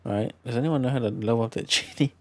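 ordinary talk, nothing marked

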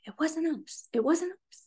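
small, high, soft protest; protesting daughter. Retold or imitated. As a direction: imitate a teenager denying it from the sidelines.

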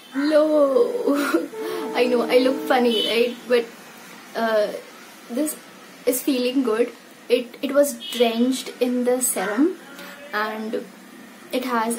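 face stiff under mask; through a sheet mask. Real, not acted. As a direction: lips framed by wet mask. Seen 0: Wet sheet mask covers face; mouth opening stays free while speaking.

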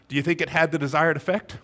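ordinary talk

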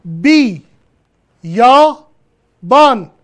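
بی، یا، بان. as three separate loud syllables. Shown as a demonstration. Teacher showing the syllables of the word, syllable by syllable. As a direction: break بی، یا، بان. apart with clear pauses. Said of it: "هجاهاشو نگاه کن".

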